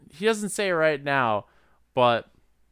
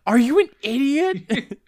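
mock exasperation; 13 semitones higher, 6 dB louder than usual. Mocking movie character aloud.

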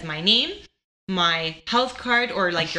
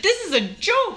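incredulous, pitched way up; mock outrage; 13 semitones higher than usual. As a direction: scoff in high disbelief. Retelling her indignant reaction.

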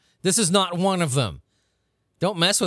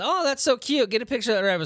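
mock gushing friend, pitched higher; cutesy companion; 6 semitones higher, faster than usual. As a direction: gush over the bunny like a naive tourist. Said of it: "the person you're with says".

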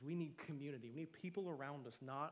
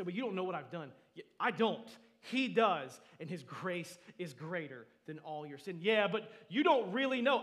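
impassioned preaching; 3 semitones higher, 13 dB louder than usual. Real, not acted. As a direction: fire back at full preaching force. Preaches an imagined argument at full force.

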